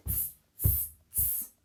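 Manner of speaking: three sharp hissed "tss" bursts; short, voiceless monkey taunts; monkey noise. Acted. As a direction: tease back like a monkey, all hiss. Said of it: "channel your inner monkey".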